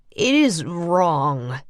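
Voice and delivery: measured, grave simple man; Lawrence's voice. A narrator's reading. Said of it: "Lawrence said".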